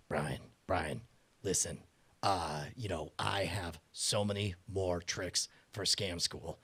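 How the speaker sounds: low, slow, mumbly friend voice; Dan Martin impression. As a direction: drop low and drawl it out. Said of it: "this is me doing my Dan Martin impression".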